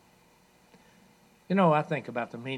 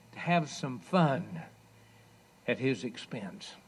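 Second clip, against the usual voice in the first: ordinary talk, nothing marked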